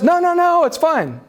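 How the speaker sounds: imitated wife, voice leaping high then easing down; high-pitched mock denial. Retelling wife denying a problem.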